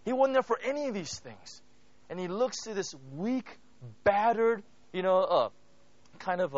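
ordinary talk

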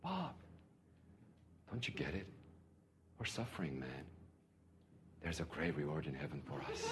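very soft, calm whispered answer; hushed reply. Retold or imitated. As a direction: murmur it serenely, untroubled. Quoting pastor's calm reply.

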